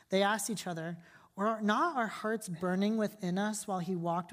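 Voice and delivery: quiet, measured Bible reading to the congregation; scripture reading. Acted. Pastor reading scripture to the church, soft and measured. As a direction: read the gospel aloud, softly and evenly.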